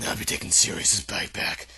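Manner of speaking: low, muffled giant's voice. A narrator's reading. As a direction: talk as if through a handkerchief. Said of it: "said Hagrid in a very muffled voice".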